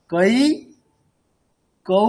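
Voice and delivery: "কৈ" drawn out to a clear i; fully finished diphthongs. Shown as a demonstration. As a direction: complete each glide in "কৈ, কৌ". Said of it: "একেবারে পুরোটা শেষ করতে হবে".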